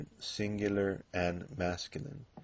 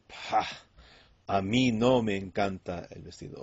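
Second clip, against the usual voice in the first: louder, punching no as he disagrees; emphatic disagreement; 6 dB louder than usual. Pretend. Man telling two ladies he dislikes the dress, emphatic. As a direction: firmly reject the dress, stress on no. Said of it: "he might say".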